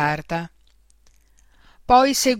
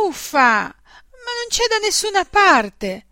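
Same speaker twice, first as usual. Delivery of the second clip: put-on high, whiny little-girl grumble; grumbling; 10 semitones higher, 4 dB louder, slower than usual. A narrator's reading. Little girl searching everywhere for her drawing, whiny grumble. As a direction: drag it out, whining in frustration. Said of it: "brontolò la bambina".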